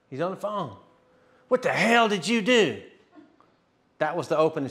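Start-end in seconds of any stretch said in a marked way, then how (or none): 1.5-2.8 high-pitched retold angry demand; mad. Johnny phoning the preacher after his wife converted, mad. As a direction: imitate an irate caller, not fully shouted. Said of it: "I knew he was mad".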